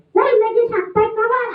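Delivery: high cheeky puppet greeting. Acted. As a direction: greet the kids too casually. Puppet greets kids rudely.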